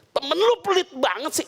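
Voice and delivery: high, indignant complaint; high mock grumble; sister complaining. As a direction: whine about the stingy friend. Sister complaining about stingy friend.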